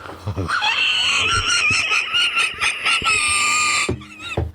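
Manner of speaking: high hysterical shriek of laughter. Real, not acted. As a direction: scream with laughter.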